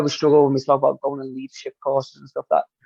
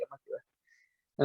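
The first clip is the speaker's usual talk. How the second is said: voiceless, slow, fading off; half-hearted mumbled promise; trailing mutter; 18 dB quieter, slower than usual. Retold or imitated. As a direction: let it die to a breath. Brushing off wife's suggestion.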